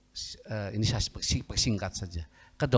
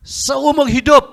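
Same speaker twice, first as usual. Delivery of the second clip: very high, booming pronouncement; acted shout, pitched way up and held; loud verdict; 16 dB louder, slower than usual. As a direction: blurt the doctor's verdict at full volume. Acted doctor gives blunt verdict.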